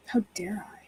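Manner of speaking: soft breathy whisper back at the cat; mock-offended whisper. Real, not acted. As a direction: pretend to be scandalised under your breath. Replying to cat's imagined scolding.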